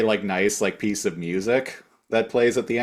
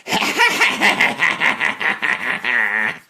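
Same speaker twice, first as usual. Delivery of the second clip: villain cackle; 4 semitones higher than usual. Retold or imitated.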